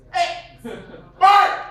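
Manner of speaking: calling out loud and high across the street; shouting a name. Retold or imitated. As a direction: yell for a friend down the street. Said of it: "to do what".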